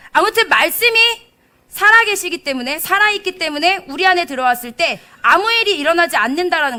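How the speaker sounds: loud, high, driving sermon voice; fervent preaching. Acted. Preacher urging the congregation about the living word. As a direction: proclaim it with full force. Preaching builds to full force.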